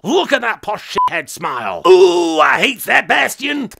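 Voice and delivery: mock rage building to a shout; comic loathing, bursting out loud; exasperated rant. Comic rant at the smug rival.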